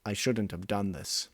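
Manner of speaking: ordinary delivery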